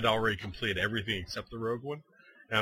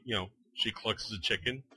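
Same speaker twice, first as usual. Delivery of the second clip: ordinary delivery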